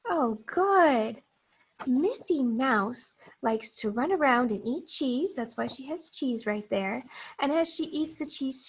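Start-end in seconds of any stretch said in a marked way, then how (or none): none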